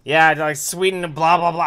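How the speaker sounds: loud, high exasperated outburst. Real, not acted. Host to interrupting co-host, loud and exasperated. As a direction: scold loudly over an interruption.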